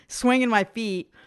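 ordinary talk

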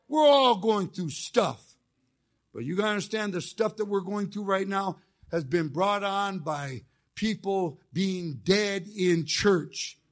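normal talking voice

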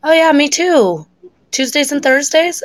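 mock eager guy. Woman mimicking the eager classmate who sat beside her. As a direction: play up his keen voice, teasing him. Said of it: "And he's like".

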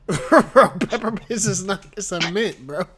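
high, loud amused protest; amused disbelief. Real, not acted. Friend correcting co-host about mints, amused and incredulous. Laughing argument over candy.

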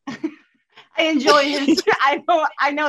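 laughing high and slow. Real, not acted. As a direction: laugh through the words. Laughs at being teased.

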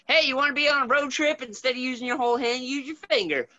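high, fast sales pitch; cheesy ad voice. Retold or imitated. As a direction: pitch it like a toy commercial. Mocking a travel game pitch.

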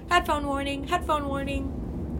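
high, loud warning call; mock announcement. Podcaster warning listeners that she may be loud, mock announcement. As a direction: sing out a warning like an alert. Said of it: "I should give, like, a headphone warning".

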